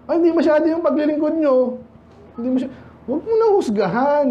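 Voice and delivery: lightly hammed-up complaining member; mock complaint. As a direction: send up the whiners, pitched high. Voices complaining churchgoers.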